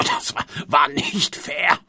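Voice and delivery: slow, breathless whispered wheeze; wheezing gasp. Acted. Choking producer gasps the words under the table, wheezing. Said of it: "röchelte er gebückt keuchend".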